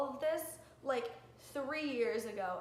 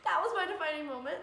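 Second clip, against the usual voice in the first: laughing it out, higher and fast; 6 semitones higher, 6 dB louder, faster than usual. Real, not acted. Vlogger laughing off her emotional moment, higher and fast. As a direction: laugh through the line, relieved. Laughing after tearing up on camera.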